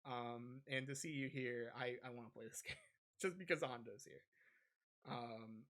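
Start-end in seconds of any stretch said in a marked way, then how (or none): none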